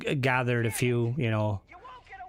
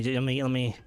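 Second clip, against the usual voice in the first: ordinary talk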